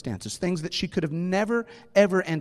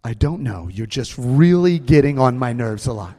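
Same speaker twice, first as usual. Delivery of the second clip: lower, louder grumpy teacher; teacher grumbling at the boy, lower and louder; deep teacher voice; 3 semitones lower, 6 dB louder than usual. Retold or imitated. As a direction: grumble it low like a fed-up teacher. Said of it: "He's like".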